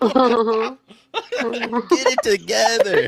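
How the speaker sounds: high squeaky words broken by laughter; laughing. Real, not acted. Teasing her friend on the stream, laughing. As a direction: laugh through the words, slowly.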